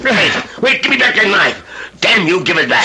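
high, fast, loud alarm; alarmed shouting. Acted. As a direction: yell to get the knife back. Struggle over the knife.

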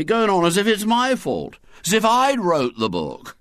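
indignant schoolboy rant, rising in pitch; self-pitying boy's protest; aggrieved grumbling boy. A narrator's reading. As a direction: pour out your wrongs, deeply put-upon.

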